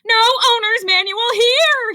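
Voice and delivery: high, loud, frantic shout. A narrator's reading. Rabbit searching the coat rack, shouting. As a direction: shout it in frustration. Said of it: "he shouted".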